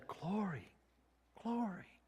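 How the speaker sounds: soft, awed murmur; reverent hush. Acted. The whole temple to the Lord, a reverent hush. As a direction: breathe it out in worship. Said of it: "the whole temple said".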